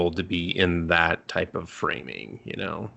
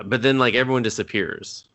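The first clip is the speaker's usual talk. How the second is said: ordinary talk, nothing marked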